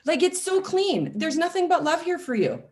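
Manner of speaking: high-pitched, emphatic retold appeal; mock pleading with mom. Daughter telling her mom she has cleaned house inside, high mock pleading. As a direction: plead it high, playfully over the top.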